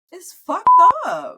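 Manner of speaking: slow, high, loud stress; emphatic. Real, not acted. Lamenting lost romance, slow high emphasis. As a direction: lean hard on each word.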